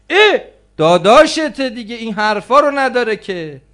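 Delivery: high, loud dismissive protest; acted-out brushing-off, almost shouted; loud mock objection. Acts out dismissive objection to formality.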